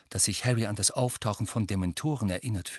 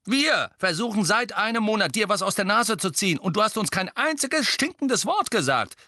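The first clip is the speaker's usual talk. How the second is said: hoch, laut und schnell; gerufen; 12 semitones higher, 5 dB louder than usual. A narrator's reading. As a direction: aufgebracht rufen. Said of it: "rief George". (Bruder ruft aufgebracht über den Tisch)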